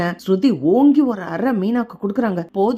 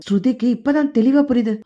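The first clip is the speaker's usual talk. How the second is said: usual speaking voice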